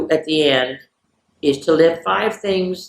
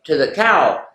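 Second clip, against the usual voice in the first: ordinary delivery, nothing marked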